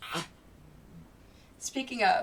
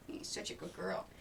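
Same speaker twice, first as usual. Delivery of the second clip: soft whispered praise to the dog; 5 semitones lower, 11 dB quieter than usual. Real, not acted. Owner whispering praise to her dog, whispered. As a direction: breathe it like a secret compliment.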